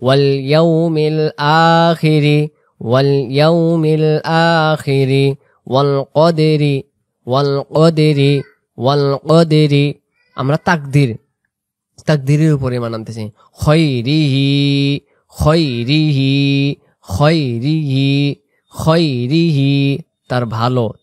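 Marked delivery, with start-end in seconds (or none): 0.0-9.9 "আখিরি" with its আ held one alif long, slow; one alif stretch. Shown as a demonstration. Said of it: "এক আলিফ টান দিব". 13.5-20.0 slow, drawn-out Arabic recitation; recitation demo. Shown as a demonstration. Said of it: "উচ্চারণটা করি".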